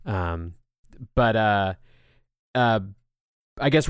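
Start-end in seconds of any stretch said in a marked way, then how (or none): none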